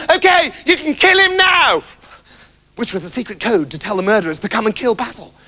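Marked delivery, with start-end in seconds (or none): 0.1-1.8 high shouted call; shouting. Acted. Septimus shouting the code to the murderers.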